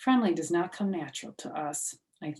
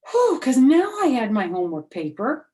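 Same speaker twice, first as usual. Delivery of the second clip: relieved whew; 6 semitones higher, 9 dB louder than usual. Acted.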